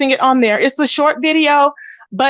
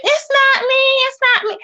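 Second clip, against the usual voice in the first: squeaky mock voice, much higher, whining her denial; 12 semitones higher than usual. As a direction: imitate her high whiny denial, nothing like your own voice. Mocking the woman's denial.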